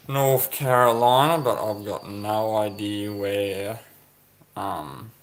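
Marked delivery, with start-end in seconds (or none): none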